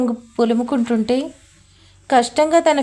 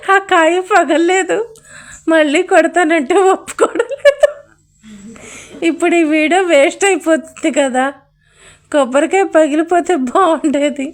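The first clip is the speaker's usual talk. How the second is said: whiny excitable woman; 4 semitones higher than usual. Acted. Kaveri whines about ruined video.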